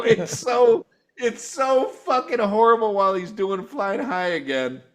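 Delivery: ordinary talk, nothing marked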